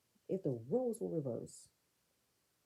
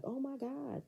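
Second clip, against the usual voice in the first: high-pitched shocked gasp of words; lightly acted disbelief; mock shock; 8 semitones higher than usual. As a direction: imitate someone shocked, pitched up. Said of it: "She's looking so shocked".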